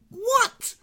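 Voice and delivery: very high, shocked "What?"; incredulous. Real, not acted. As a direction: squeak it in disbelief. Disbelief at dad's remark.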